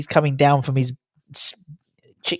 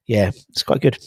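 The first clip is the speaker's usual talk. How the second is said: usual speaking voice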